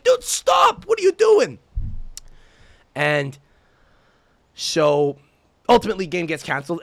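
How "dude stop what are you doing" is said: very high, loud reenacted shout; exasperated yell. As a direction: yell at the ref in cracking-high disbelief. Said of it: "I yelled at him".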